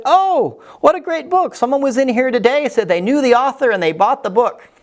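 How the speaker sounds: excited clerk. Retold or imitated. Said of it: "she said".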